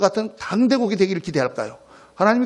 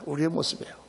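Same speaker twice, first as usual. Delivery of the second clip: much softer, lower repeat; hushed echo; 5 semitones lower, 11 dB quieter than usual. Real, not acted. Preacher repeating the hard truth to congregation, hushed echo. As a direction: let it drop to a quiet admission.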